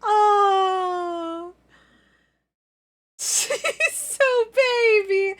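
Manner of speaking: high, drawn-out cooing; slow high-pitched gush of affection; adoring coo. Real, not acted. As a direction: melt over a tiny cute thing. Coos over cute avatar.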